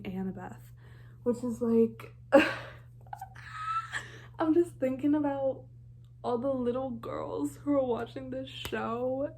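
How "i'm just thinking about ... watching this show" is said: choked up with real emotion, a touch higher; voice cracking up. Real, not acted. Fan telling viewers about little girls, voice cracking. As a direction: near tears, pushing the words out.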